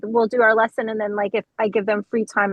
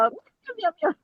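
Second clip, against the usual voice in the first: puppet voice; 7 semitones higher, 5 dB quieter than usual. Retold or imitated. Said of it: "I do a voice".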